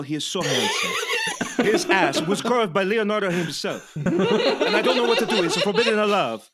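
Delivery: laughing mock Italian priest caller; hammed-up Italian accent. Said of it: "do the accent as offensive as possible".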